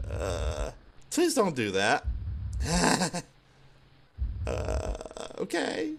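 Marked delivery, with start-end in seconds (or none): none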